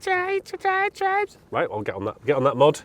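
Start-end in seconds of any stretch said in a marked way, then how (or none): 0.1-1.4 very high, loud repeated yelps; imitated game shouts. Everyone in the game spamming the jump call, high squeaky shouting. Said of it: "So, so everyone's tribes".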